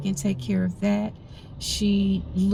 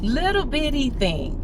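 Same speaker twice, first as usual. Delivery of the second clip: "Little bitty thing" lifted high and fond; cute emphasis; 6 semitones higher than usual. Shown as a demonstration. Correcting herself about the tiny landscaper, voice pitched up high. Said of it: "I'm sorry".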